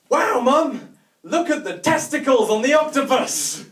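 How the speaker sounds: put-on high, loud, amazed kid; excited child shout. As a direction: yell it to Mum in wonder.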